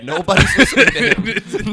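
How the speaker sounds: loud, high, laughing through the words; laughing hard. Real, not acted. Repeating what the coworker said, laughing hard. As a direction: crack up while speaking. Cracking up while repeating the line.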